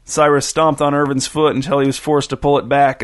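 usual speaking voice

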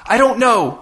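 high, strained near-crying; on the verge of tears. A narrator's reading. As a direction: burst out helplessly, close to tears. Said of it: "on the verge of tears".

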